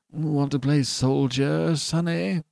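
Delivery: slow, casual patronizing question. A narrator's reading. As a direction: ask it lazily, as a clerk humoring a child. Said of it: "demanded the clerk casually".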